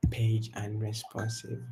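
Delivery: ordinary delivery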